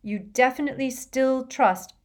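strained courtesy; forced politeness. A narrator's reading. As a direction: ask politely while holding annoyance back. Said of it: "in what he hoped was a polite, calm voice".

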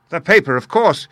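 loud, high yell of excitement; excited yell. Acted. Holmes realising the clue, excited high yell. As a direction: yell it in a burst of discovery. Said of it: "yelled Holmes in a paroxysm of excitement".

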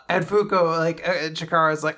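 usual speaking voice

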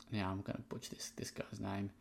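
under-the-breath aside; whispering. Real, not acted. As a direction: whisper it to yourself. Aside before mangling a name.

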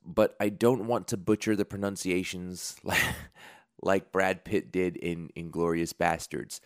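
ordinary talk

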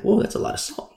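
whispered aside; hushed surprised whisper. Acted. Servant reacting to salt.

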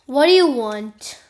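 sharp loud question; loud demand. Acted. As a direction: demand it loudly like a king. King confronts visitor.

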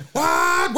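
loud, high engine wail; race car imitation. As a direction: roar like a passing race car. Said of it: "imitate a race car the best".

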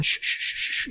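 repeated soft shushing sound; hushing. Retold or imitated. Co-host imitating Janosch shushing talk about the painting, soft hiss. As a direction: hush someone urgently under the breath. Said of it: "Janosch is kinda going".

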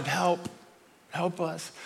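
softly voiced, weary plea; small plea for help. Miserable Israel finally asks God, softly pleading. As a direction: plead quietly, trailing down.

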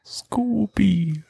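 squeaky playful scoopy; high silly scoopy. Acted. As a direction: pitch it way up, goofy. Joking on spooky word.

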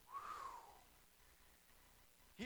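high whistled puff of breath; disgusted whew. Acted. Preacher reacts to Lazarus's smell, mock-disgusted whew. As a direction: blow out at the stink.

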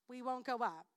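normal talking voice

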